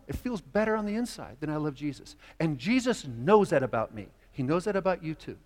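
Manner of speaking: normal talking voice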